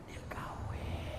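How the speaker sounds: soft unvoiced secret; gossip whisper. Women whisper that the old man married. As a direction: whisper the news like scandal.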